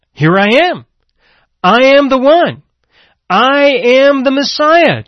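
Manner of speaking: high, loud preaching cry of Jesus; Jesus declaring. Acted.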